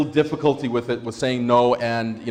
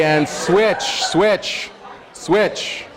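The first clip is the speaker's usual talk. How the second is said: calling out; 7 semitones higher, much slower than usual. Real, not acted. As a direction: call the room to change over. Calling out to room.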